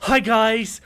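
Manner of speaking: American tourist greeting followers, mock American accent. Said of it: "an American wandering around Edinburgh going".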